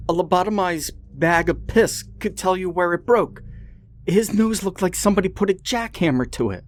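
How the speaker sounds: put-on high, fast, exasperated girl; exasperated Penny voice. Penny lectures Ben about the fight, high and exasperated. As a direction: rattle it off in a high exasperated rush.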